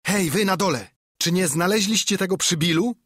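insistent shouted question; calling out. A narrator's reading. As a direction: call across to the men below. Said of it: "wołał znów ślepiec".

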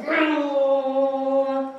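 long, loud, held vroom; engine vroom. Acted. Duck in a helmet ready to race, loud engine noise. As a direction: imitate a revving engine on one sustained note.

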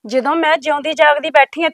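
ordinary talk, nothing marked

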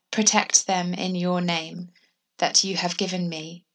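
normal talking voice

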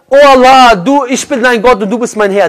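a touch of overjoyed crying out, opening loud; the found man blurting out his thanks; joyful. Acted. Man who finds his camel again calling to God, mild joyful outburst. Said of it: "sagt vor Freude".

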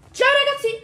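high shouted farewell; loud cheerful goodbye. Real, not acted. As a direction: call out goodbye. Loud sign-off.

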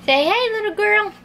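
high sing-song phrase for a parrot; teaching a parrot. Shown as a demonstration. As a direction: pitch it up so the bird copies it.

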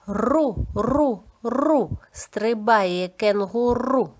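long trilled R in "Ру" and "кенгуру"; clear R articulation. Shown as a demonstration. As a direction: bounce through "Ру, ру, ру", then slow "кенгуру". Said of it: "Чітко вимовляєте звук Р".

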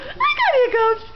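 high, excited squeaky dog voice; voicing the pet in a laughing high pitch; talking for the dog. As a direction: answer as the dog, high and gleeful. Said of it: "She goes".

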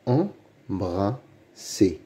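"Embrasser" very slowly, in three separate beats; syllable by syllable. Shown as a demonstration. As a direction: sound out "Embrasser" with pauses. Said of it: "Lis les mots". (« Embrasser » très lentement, syllabe par syllabe)

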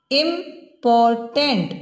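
slow "इम्पॉर्टेंट" in three separate beats; syllable by syllable. Shown as a demonstration. Teacher showing three syllables, "इम्पॉर्टेंट" said part by part. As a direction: break "इम्पॉर्टेंट" into "इम", "पॉर", "टेंट" with pauses. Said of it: "अब इसमें देखिए आप three syllable".

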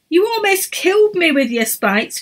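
high, indignant complaint; indignant cry. A narrator's reading. Emma spiked in the tent, indignant cry. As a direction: cry out, wounded and cross. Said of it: "cried Emma".

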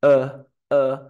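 "Eh, eh" como schwa, vocal neutra, corta y fuerte. Shown as a demonstration. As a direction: suelta dos schwas sueltas: "Eh, eh". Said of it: "¿Cómo era la schwa?". ("Eh" neutro y relajado, con volumen)

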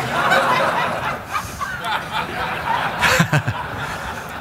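high breathy mock Nicholson laugh; Nicholson cackle. Dad imitating Nicholson, a high breathy cackle. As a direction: let out a sly unvoiced cackle.

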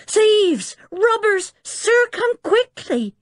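high, screamed cry of alarm; panicked yell. A narrator's reading. Maid rousing the sleeping professor, panicked yell. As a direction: yell for help at a very high pitch. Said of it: "yelling at the top of her voice".

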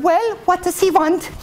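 high-pitched princess voice. Acted. Princess questioning her lady in waiting, high voice. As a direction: ask in a high, put-on voice. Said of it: "And the princess said".

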